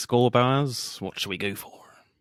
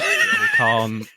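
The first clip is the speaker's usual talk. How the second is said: mock baffled viewer; slower than usual. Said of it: "'cause you're like".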